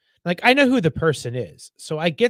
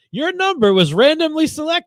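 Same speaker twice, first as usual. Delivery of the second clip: mock robocall voice; 10 semitones higher than usual. Robocall announcing a prize, mock salesy. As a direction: sound like a canned sales pitch. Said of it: "I get the calls where it's like".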